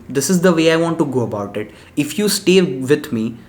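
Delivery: normal talking voice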